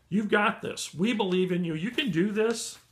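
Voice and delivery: rally the viewer, upbeat; excited encouragement. Real, not acted. Upbeat pep talk to viewers.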